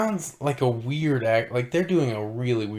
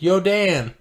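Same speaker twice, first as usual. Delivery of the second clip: higher, louder shouted Yo, Dan!; mock shout; 7 semitones higher, 4 dB louder than usual. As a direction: yell "Yo, Dan!" as the misheard call. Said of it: "I thought he was saying".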